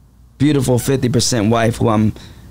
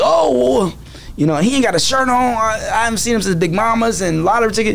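higher-pitched dismissive imitation; mocking critics; 6 semitones higher than usual. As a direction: mimic the doubters in a raised voice. Mocking what critics would say.

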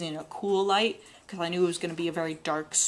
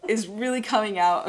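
laughing about her funny accent; 5 semitones higher, 5 dB louder than usual. Real, not acted. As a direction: giggle through the words. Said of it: "My funny, funny accent".

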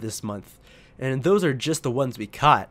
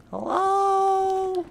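squeaky high-pitched call; high silly voice; 3 dB quieter, slower than usual. Pretend. Calling out high, far voice.

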